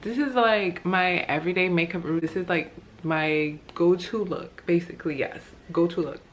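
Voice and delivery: slight lisp from retainer; talking with a retainer in; wearing a retainer. Real, not acted. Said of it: "If I have a lisp, it's because I have my retainer in".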